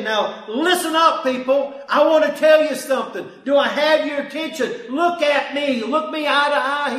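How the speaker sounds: loud, commanding call to listen; Jesus demanding attention. Acted. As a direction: command the crowd as the Lord would. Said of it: "He's saying".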